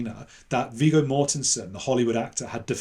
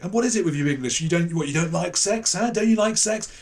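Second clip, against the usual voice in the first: higher, louder, goading; taunting panellist; 6 semitones higher, 4 dB louder than usual. Retold or imitated. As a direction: needle the English guest. Panellist taunting about sex.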